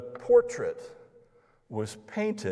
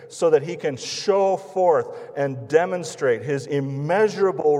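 preaching at full force; 4 semitones higher, 6 dB louder than usual. Real, not acted.

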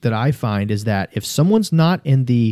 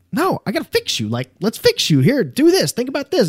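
mock eager fixer; 12 semitones higher than usual. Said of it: "And I'm like".

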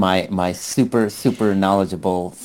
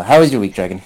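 normal talking voice

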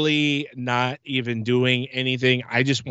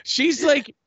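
usual speaking voice

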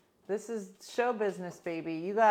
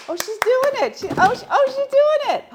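much higher and louder, squealing with joy; thrilled squeal; 15 dB louder than usual. Real, not acted. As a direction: shriek with delight as she does it. Pet finally climbs the steps.

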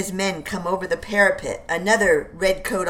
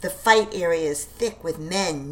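usual speaking voice

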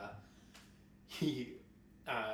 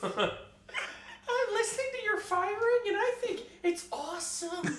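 much higher, slow, goofy fan voice; radio caller. Pretend. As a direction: play an overexcited listener calling in. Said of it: "we got a call from Columbus".

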